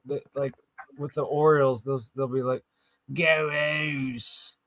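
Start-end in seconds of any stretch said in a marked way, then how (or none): 3.1-4.6 quick Baltimore accent impression; light Baltimore accent cheer. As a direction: say it like a Baltimore Orioles fan. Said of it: "they pronounce things like".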